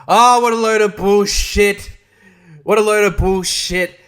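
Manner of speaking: loud, high scoffing rant; scornful outburst. Real, not acted. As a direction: blast the film with contempt. Ranting at the film.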